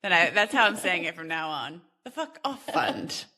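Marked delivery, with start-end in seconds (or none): 2.1-3.2 "Fuck" stretched out, South African style; South African accent. Shown as a demonstration. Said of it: "South African accent going in there".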